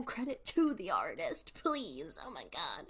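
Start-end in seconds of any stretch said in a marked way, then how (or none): none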